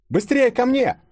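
very high, loud call; urgent shout. A narrator's reading. Girl calling her friends to her, urgent high shout. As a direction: call them over urgently. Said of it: "крикнула девушка".

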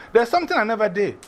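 ordinary delivery

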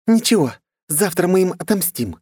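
put-on high, determined girl voice; vengeful girl. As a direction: vow revenge. Sister vows revenge. (девичий голос с решимостью)

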